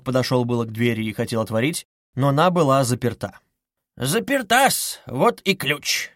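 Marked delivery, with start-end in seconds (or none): 4.0-6.1 slow, high-pitched lilt; sly taunting. A narrator's reading. Investigator producing the key for suspect, sly taunt. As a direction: savour every word teasingly.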